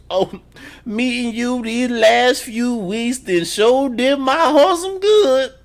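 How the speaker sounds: put-on high, slow, sing-song old church lady; mock churchy mama. Mocking the mama's pious words to Bumpy's friend, high sing-song. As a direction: ham up a pious mama, drawn out.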